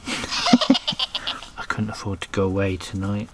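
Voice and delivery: laughing through the words. Real, not acted. Repeats joke, laughing.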